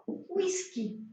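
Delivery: whisky said the Portuguese way, high and soft; Portuguese word whisky. Shown as a demonstration. Teacher showing students the Portuguese whisky, soft and high. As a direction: give whisky its Brazilian sound. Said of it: "la palabra".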